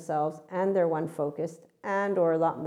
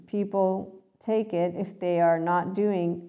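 usual speaking voice

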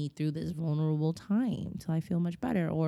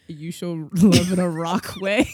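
loud, slow and laughing; laughing mock quote; 9 dB louder than usual. Friend teasing her best friend, loud and laughing. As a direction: tease her about loving like a rock.

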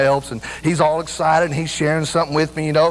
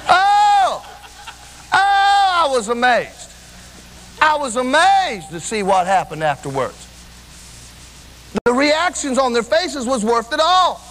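impassioned preaching; 8 semitones higher than usual. Acted. Preacher telling the church how hard rejoicing was, impassioned. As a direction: preach it with rising fervor, stretching the big words.

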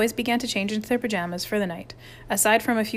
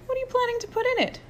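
Pierre pitched high and fast, in disbelief; a startled high squeak of a question; high, fast counselor voice; 10 semitones higher than usual. A narrator's reading. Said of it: "Pierre repeated".

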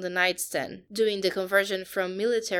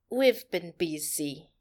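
put-on slow, lower Russian-accented voice; 4 semitones lower, slower than usual. A narrator's reading. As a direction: drag it out slowly in a Russian accent. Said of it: "Alexandra said".

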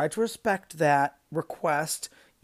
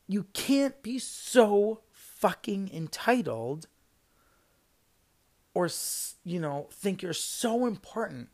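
indignant telling-off; 3 semitones higher, 4 dB louder, slower than usual. Real, not acted. Manager ordering rude customer out, slowly and angrily. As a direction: lay down each word with heated force.

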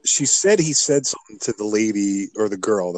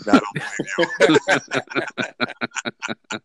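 laughing disbelief; 4 semitones higher, much slower than usual. Retold or imitated. Father mocks disbelief.

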